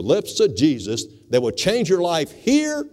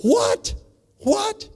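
sharp, much higher double what; incredulous. Real, not acted. Preacher reacting to doubtful salvation with disbelief. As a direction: disbelief jumping way up.

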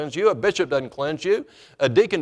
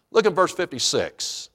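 ordinary talk

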